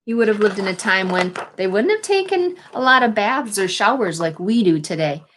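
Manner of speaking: ordinary talk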